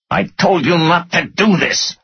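high-pitched furious scolding; screaming anger. A narrator's reading. Finance director scolding his boss, screaming in anger. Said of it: "he screamed".

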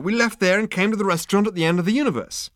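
loud, high-pitched protest. A narrator's reading. Zaphod insists to waiter about their journey, protesting loudly. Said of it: "protested Zaphod".

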